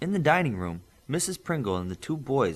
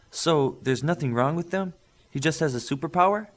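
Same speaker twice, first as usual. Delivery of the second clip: happy; 6 semitones higher, 5 dB louder than usual. Acted. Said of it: "Mister Pringle said happily".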